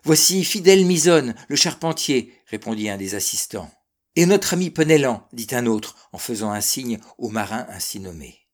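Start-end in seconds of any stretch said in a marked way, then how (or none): 0.0-2.3 loud, high-pitched shout of recognition; excited onlooker's call. A narrator's reading. Onlookers calling out sailors they recognize. 4.2-5.3 loud, lifted call of recognition; higher, eager onlooker's shout. A narrator's reading. Another onlooker calls out a sailor.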